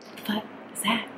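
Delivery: faint whispered disbelief; breathy whisper. Retold or imitated. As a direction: whisper it in horror, almost no voice. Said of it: "I was like".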